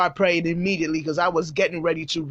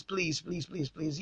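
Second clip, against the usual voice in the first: pleas dropping almost to a murmur; soft pleading; 3 semitones lower, 13 dB quieter than usual. Real, not acted. Pastor softly begging wives not to react, hushed pleading. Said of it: "pleading softly".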